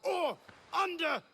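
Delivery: hammy stage war cry; rehearsed battle cry. Acted. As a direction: bellow it like a general rallying soldiers. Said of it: "I was rehearsing a monologue".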